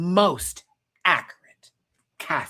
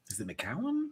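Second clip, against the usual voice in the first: soft unvoiced whisper; muttered question to self; 10 dB quieter than usual. Real, not acted. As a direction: whisper the name as you search for it.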